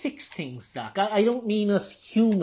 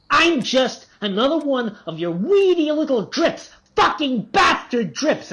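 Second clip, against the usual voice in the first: higher, sneering rage at himself; bitter outburst; 8 semitones higher than usual. Acted. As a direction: spit out the self-loathing. Patient lashes out at doctor.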